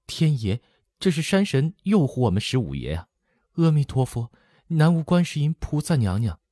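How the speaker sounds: put-on high-pitched young girl's voice; high voice climbing as she calls on the gods; girl's grateful invocation. A narrator's reading. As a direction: pray thanks in a high, relieved voice. Girl thanks the gods after danger.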